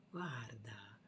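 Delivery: whispered look; hushed pointing out to a baby; soft whisper. Pretend. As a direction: hush it to the little one. Whispering to the child.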